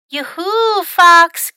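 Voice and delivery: high, loud, singsong-style yoo-hoo call. A narrator's reading. Mom looking for hiding Fox, loud high call. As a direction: holler for a hidden child. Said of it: "called Mom".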